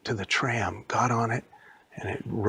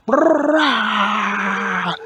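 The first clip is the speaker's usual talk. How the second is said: loud, high long roar; huge scary roar; big roar; 10 semitones higher, 10 dB louder than usual. Acted. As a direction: roar with everything. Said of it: "Elvie went".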